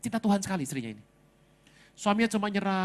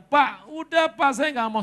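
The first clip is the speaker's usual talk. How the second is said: high, louder imitated protest; protesting wife; 8 semitones higher, 6 dB louder than usual. Wife telling her pastor she refuses, protesting. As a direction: play a wife who has had enough.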